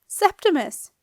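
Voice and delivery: high loud yell of a name. A narrator's reading. Marcia spotting Septimus in the glass, a high loud yell. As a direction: call him out loud and high. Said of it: "yelled Marcia".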